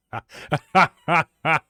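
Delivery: loud scornful guffaw; loud high mocking guard laugh. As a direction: laugh him off as a fool. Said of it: "he starts laughing".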